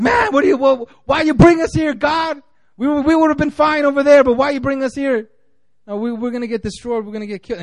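high, strained panic, pleading with God; panicky whining. Retold or imitated. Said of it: "kinda have, like, a nervous breakdown".

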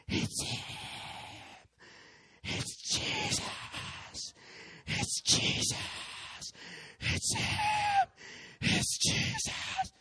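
high, very slow, breaking cry of wonder; straining, awestruck shout; strained cry. Retold or imitated. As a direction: cry it out as if overwhelmed. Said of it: "and he's shouting".

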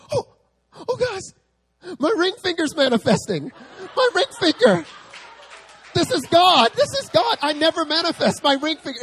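thrilled her finger is tingling; excited pastor. Retold or imitated. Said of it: "she's like".

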